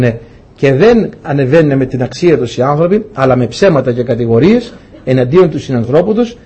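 ordinary delivery

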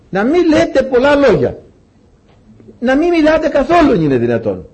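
high, forceful plea that drops at the end; emphatic exhortation. Real, not acted. Exhorting congregation not to talk.

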